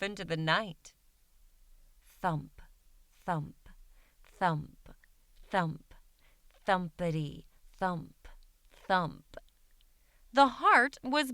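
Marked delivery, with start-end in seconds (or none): 2.2-9.4 low, soft, slow thumps like a heartbeat. A narrator's reading. A lost heart beating alone in the dark, low soft thumps. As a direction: let each thump fall like a beating heart.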